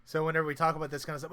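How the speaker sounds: usual speaking voice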